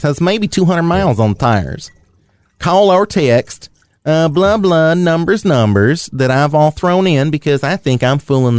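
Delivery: put-on reading the ad like a Tennessee good old boy; Tennessee accent. Podcast host reads a car ad to his cohost in a mild Tennessee accent. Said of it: "let me finish the rest of this ad in a Tennessee accent".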